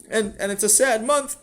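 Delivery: put-on high, insistent child's voice; earnest upset boy. Sammy explains the month to his father, high and earnest.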